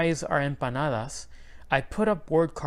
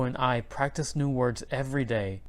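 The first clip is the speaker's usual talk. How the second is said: plain reading voice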